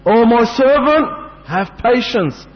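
exalted, measured divine address; God addressing His servant. Acted. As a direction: proclaim it slowly from on high. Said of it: "telling them".

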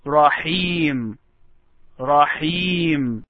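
رحيم with breathy h and long "ee"; careful isolated رحيم; pronunciation model. Shown as a demonstration. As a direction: draw out the end of رحيم, falling. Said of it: "just pronounce this word".

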